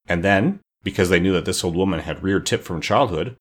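ordinary delivery

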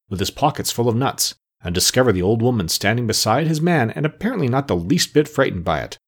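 ordinary delivery, nothing marked

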